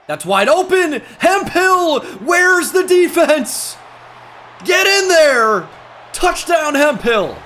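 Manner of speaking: yelling high and loud at a wide-open touchdown; full-throated play-call hype, climbing to a roar; real game-day roar; excited shouting. Real, not acted. Big play in his football game.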